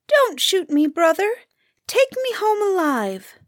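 high little bird voice, begging; pleading grouse. A narrator's reading. Grouse begs hunter for its life.

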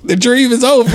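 laughing, high and loud; laughing mock mourning.

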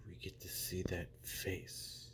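taunting whisper. Acted. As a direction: breathe it like a secret threat. Menacing promo, hushed aside.

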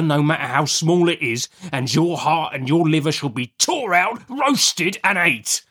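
rustic convict laying down terrifying terms; fearful threats. Acted. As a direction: start measured, then climb to a gory threat. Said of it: "went on in these fearful terms".

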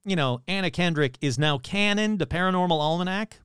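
usual speaking voice

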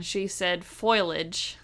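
foliage said as FOY-lij; mispronounced foliage. Retold or imitated. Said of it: "Instead of foliage".

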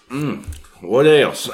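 slow, casual, unbothered; unfazed guy. Retold or imitated. Man after drinking the bowl, slow and casual. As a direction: savor it like it was nothing. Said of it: "he was like".

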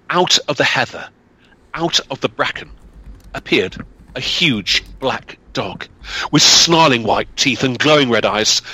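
usual speaking voice

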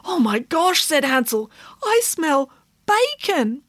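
high squeaky alarmed piglet; panicked little pig, slowing on bacon. A narrator's reading. Piglet Hansel voiced, alarmed.

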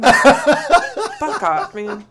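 loud, high laughing retort; laughing protest. Real, not acted. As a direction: burst out laughing as you tell them off. Laughing at partner's teasing interruption.

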